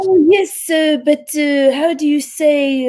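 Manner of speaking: fishing for a French word to sound posh; French-tinged English accent. Retold or imitated. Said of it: "with a French accent when she speaks English".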